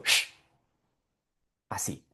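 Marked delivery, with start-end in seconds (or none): 0.0-0.3 "Shh" as a long hushing hiss. Shown as a demonstration. Teacher shows how Spanish speakers hush, soft shh. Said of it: "lo usamos cuando mandamos a callar".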